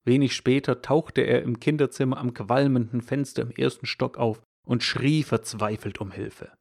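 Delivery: normal talking voice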